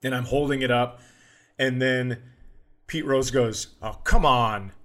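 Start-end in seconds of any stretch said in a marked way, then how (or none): none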